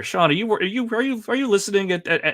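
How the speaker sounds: high, playful call to Sean; teasing call-out. Real, not acted. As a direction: catch him out, mock-scolding. Teasing Sean for listening at work.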